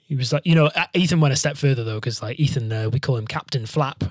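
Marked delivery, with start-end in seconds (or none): none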